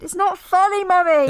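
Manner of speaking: put-on high, loud child's whine; whiny child. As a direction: complain like an indignant little girl. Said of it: "And then she said".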